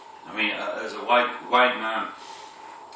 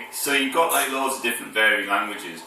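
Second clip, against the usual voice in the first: ordinary talk, nothing marked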